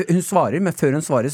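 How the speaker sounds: ordinary delivery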